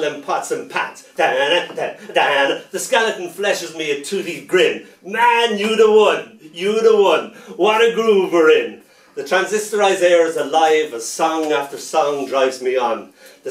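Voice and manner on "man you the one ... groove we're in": higher, louder hip jive voice; skeleton's cool praise. Acted. Skeleton grinning at his dancing partner, higher and louder jive talk. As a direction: hype up your dance partner.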